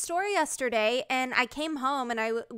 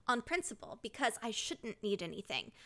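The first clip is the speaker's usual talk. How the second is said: ordinary talk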